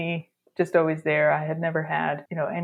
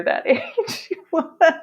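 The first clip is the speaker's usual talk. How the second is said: high laughing words; 12 semitones higher, 4 dB louder than usual. Real, not acted. Laughing about being a kid copying the record.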